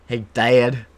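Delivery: put-on slight southern accent. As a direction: imitate Akron cousins with a southern lilt. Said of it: "a slight southern accent".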